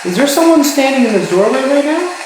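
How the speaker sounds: loud high call at the doorway. Real, not acted. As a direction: call out to someone unseen. Calling out to presence in doorway.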